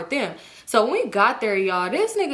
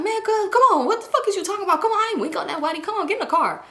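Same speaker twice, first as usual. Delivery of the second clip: imitating defensive boyfriend; 11 semitones higher, faster than usual. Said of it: "He's like".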